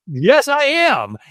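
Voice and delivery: high, drawn-out protest; acted exasperated comeback; emphatic insistence. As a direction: insist it slowly, pitch jumping up. Acted back-and-forth, guy insisting he will divorce.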